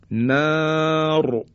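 "نار" with a long held alef and thick R; long vowel, heavy R. Shown as a demonstration. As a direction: hold the ا of "نار", then a heavy ر. («نار» با الف کشیده و رای درشت)

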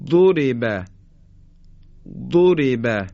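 ضرب with a strong, held ض; dhad demo. Shown as a demonstration. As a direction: press ض in ضرب. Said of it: "به تلفظش دقت بفرمایید".

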